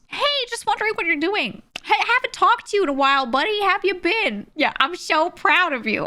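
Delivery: butter her up like a fake old pal; fake friendly DM. Old acquaintance sliding into her DMs, high mock-friendly.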